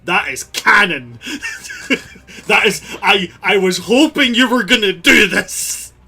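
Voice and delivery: loud, high excited outburst with laughter; thrilled, laughing exclamation; delighted. Real, not acted. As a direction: burst out gleefully through a laugh. Delighted that player talked to Boo.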